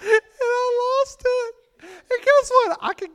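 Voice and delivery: high laughing voice; real laughter. Real, not acted. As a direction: still laughing while speaking. Still laughing.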